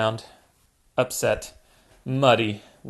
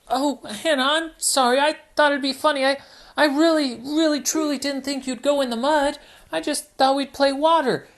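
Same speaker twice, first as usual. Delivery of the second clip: high, pleading little piglet; apologetic Peppa; 12 semitones higher than usual. Acted. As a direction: beg forgiveness, high and quick.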